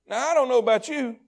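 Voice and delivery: usual speaking voice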